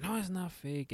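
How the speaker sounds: retold kid protesting. Boy's reply to uncles, retold.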